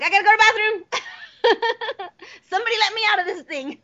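high, fast, laughing through it; laughing plea. Retold or imitated. Voice actor recalling the booth, high laughing plea. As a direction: squeal it out between laughs. Retold urge to escape booth.

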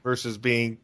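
ordinary talk

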